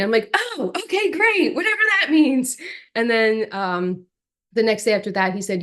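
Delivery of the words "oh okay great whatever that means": high, lilting unsure okay; confused. Retold or imitated. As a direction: play along without understanding. Confused reply to agent.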